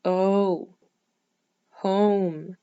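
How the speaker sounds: home with a clear drawn-out oh; long O demo. Shown as a demonstration. Teacher modelling the long O in home. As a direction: sound out the O in home slowly. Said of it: "The word home has the long O sound".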